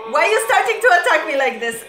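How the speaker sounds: fast, loud, high-pitched complaint; playful protest. Real, not acted. Fan complaining the editors are attacking her, playful protest. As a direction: rattle it off, overwhelmed and mock-accusing.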